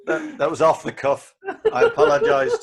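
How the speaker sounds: high, loud bursting laugh through the words; cracking up at his own outburst. Real, not acted. After swearing on the podcast, laughing it off loudly.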